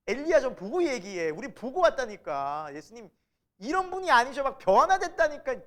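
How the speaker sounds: loud, high, bragging retort; boasting disciples. Acted. Peter, James and John boasting to the other disciples, loud and bragging. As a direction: brag like you saw it firsthand. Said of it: "베드로, 야고보, 요한".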